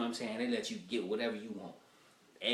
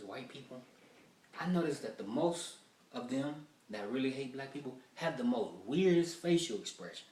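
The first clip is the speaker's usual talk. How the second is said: usual speaking voice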